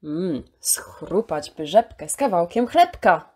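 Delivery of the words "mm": ordinary delivery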